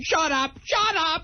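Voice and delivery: high-pitched exasperated lawyer's yell; hammed-up, exasperated high scream; mock screaming. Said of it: "a lawyer screaming at his radio".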